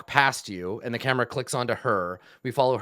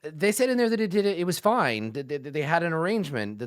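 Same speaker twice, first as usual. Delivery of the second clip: higher-pitched, stumbling worry; flustered protest; 8 semitones higher than usual. Acted. Waitress objecting to the goblin's paperwork threat, flustered. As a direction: halt them, stammering in mild alarm.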